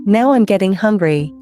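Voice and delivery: ordinary delivery, nothing marked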